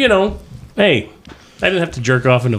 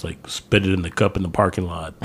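soft, lower words breaking into a laugh; laughing quietly; 9 semitones lower, 8 dB quieter than usual. Real, not acted. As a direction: mumble it while cracking up. Sheepish suggestion, laughing.

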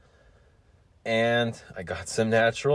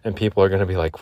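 normal talking voice

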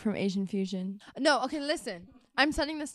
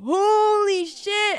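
stretched-out, louder, higher shocked gasp of words; shocked exclaim; 8 semitones higher, 7 dB louder, much slower than usual. Retold or imitated. Bystanders spotting a rat under the table, slow loud shock. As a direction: drag it out like you just saw a huge rat.

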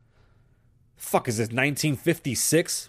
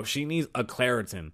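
ordinary talk

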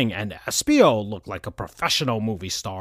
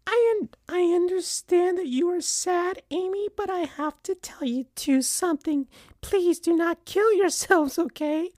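earnest plea in high falsetto; put-on mother voice, pleading sorrowfully; very sad, meaning it. A narrator's reading. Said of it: "in a very sad and meaning it way".

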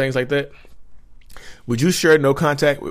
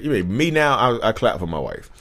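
ordinary delivery

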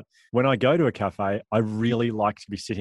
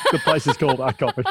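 corny dad laugh; 3 semitones higher, 3 dB louder than usual. Real, not acted. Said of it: "That's such a dad joke".

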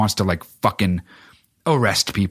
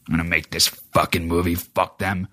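Clint impression; 5 semitones lower than usual. Host imagining the director's motive, low grumbling impression. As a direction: grumble it low, drawing out the curse. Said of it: "you can just see Clint behind it".

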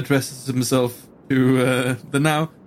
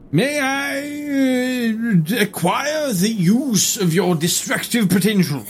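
formal gentleman voice; 9 semitones higher, much slower than usual. Acted. Herodotus asks in character.

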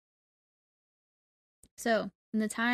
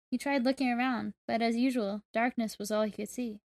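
usual speaking voice

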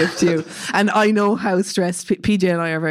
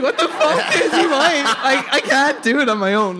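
panicked; 10 semitones higher than usual. Retold or imitated. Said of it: "being like".

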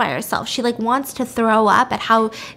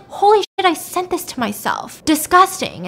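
higher, louder burst of disgust; appalled outburst; 6 semitones higher, 4 dB louder than usual. Retold or imitated. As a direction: recoil in shocked self-disgust. Acted shock at own email.

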